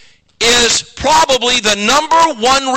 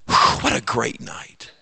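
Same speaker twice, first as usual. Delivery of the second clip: relieved whisper; 11 semitones lower, 7 dB quieter than usual. Retold or imitated. Retelling his relief after sermon.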